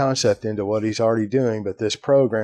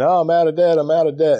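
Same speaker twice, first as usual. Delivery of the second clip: higher, louder mock-jubilant caller voice; excited callers; 6 semitones higher, 5 dB louder, faster than usual. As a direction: imitate callers gushing with relief. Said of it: "people call in".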